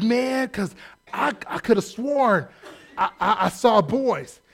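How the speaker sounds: slow, laughing stammer; sheepish laugh. Retold or imitated. Caught-out prophet, laughing sheepishly. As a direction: laugh through the excuse.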